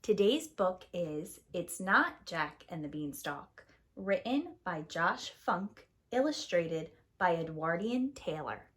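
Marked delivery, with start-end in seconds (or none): none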